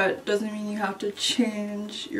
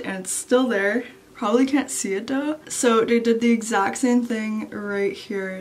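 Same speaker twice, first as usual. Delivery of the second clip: lip pulled aside with finger; talking around own finger; finger in mouth. Real, not acted. Seen 2: Finger on front teeth and pulling lip aside to show braces.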